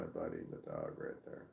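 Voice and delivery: low, soft loving murmur; tender. Real, not acted. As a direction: murmur fondly to the dog. Cooing at dog.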